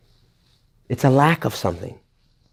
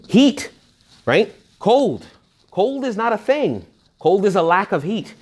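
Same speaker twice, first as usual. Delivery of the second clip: high, bright-eyed teaching example with heat and cold; animated; 8 semitones higher, slower than usual. Real, not acted. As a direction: lift the pitch up and lead the listeners with excitement.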